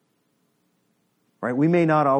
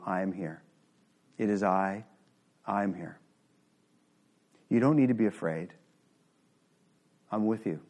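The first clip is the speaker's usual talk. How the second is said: gentle reassurance; 7 semitones lower, 6 dB quieter than usual. Retold or imitated. Jesus voiced comforting listeners.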